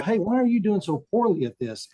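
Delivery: a hint of teasing reproach; mock call-out. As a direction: play the presenter putting him on the spot. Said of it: "And then they go".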